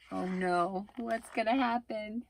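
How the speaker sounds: usual speaking voice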